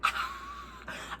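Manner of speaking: very high, soft delighted squeal; tiny high-pitched fangirl squeal. Real, not acted. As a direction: squeal at how cute it is. Reacts to cute story.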